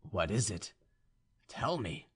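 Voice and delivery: soft, low question; subdued suitor. A narrator's reading. Young man awaits her condition, soft and humble. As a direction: ask it in a hushed voice.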